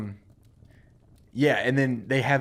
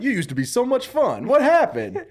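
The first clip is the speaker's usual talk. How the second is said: high, loud whiny question; hammed-up complaint; 10 semitones higher, 5 dB louder than usual. The dead husband complains to his wife, high and loud. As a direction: complain she lost her fun. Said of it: "And he's like".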